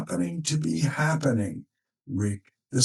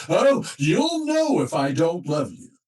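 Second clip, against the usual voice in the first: huffy; 5 semitones higher than usual. Retold or imitated. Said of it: "with a huff said".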